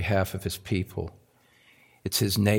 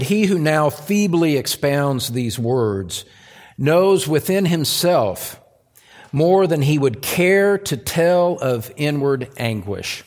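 raised, emphatic pulpit reading; declaimed quotation; 4 semitones higher, 5 dB louder than usual. Acted. Preacher quotes Spurgeon on inward anguish, declaimed with force. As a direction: proclaim each phrase to the whole congregation.

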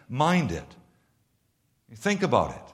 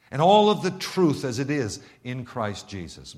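normal talking voice

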